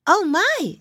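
put-on high, loud little rabbit girl; surprised exclaim. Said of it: "exclaimed a little rabbit girl".

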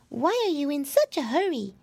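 high storybook mouse voice; high-pitched tiny creature; little mouse. A narrator's reading. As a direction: ask like a small mouse. Mouse questions the piglet.

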